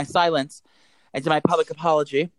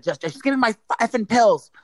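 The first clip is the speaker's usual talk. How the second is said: higher, exasperated demand; retold snapping; 6 semitones higher than usual. Customer who snapped at the pharmacy, exasperated retelling. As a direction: imitate snapping, holding the swearing back. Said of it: "I finally just snapped".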